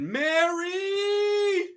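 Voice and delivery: high desperate scream of a name. Retold or imitated. Man screaming for Mary when the devil appears, desperate high scream. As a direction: cry out to heaven in terror. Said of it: "I screamed out to heaven".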